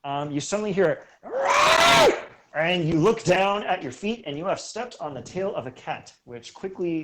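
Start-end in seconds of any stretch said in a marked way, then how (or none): 1.2-2.1 high, loud cat yowl; screeching cat yowl. Acted. GM voicing a stepped-on cat, a loud high yowl. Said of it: "That was a really good meow".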